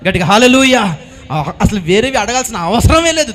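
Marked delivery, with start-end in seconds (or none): 0.1-1.0 shouted hallelujah to the congregation; loud hallelujah. Real, not acted. Preacher leads the church in a loud hallelujah. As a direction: call it out with full force. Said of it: "స్తోత్రం చెప్పినట్టు ఒకసారి".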